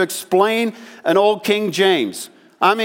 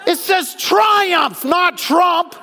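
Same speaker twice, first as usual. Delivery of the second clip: punchline shout; 11 semitones higher, much slower than usual. Acted. Punchline correcting the misreading.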